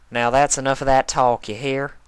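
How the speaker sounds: firm country scolding; Applejack scolding. A narrator's reading.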